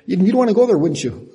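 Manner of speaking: ordinary delivery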